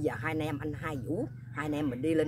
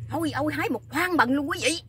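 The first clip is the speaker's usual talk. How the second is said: excited exclamation; 10 semitones higher, 5 dB louder than usual. Retold or imitated. Excited retelling of big fruit find.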